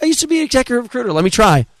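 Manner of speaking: high, fast motherly protest; insistent mom. Retold or imitated.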